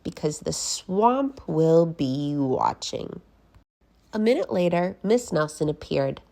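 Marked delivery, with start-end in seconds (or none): 0.0-3.2 slow, ominous threat; menacing witch. Acted. Miss Swamp threatens the class, slow and ominous. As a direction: warn them slowly. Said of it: "said Miss Swamp to the class".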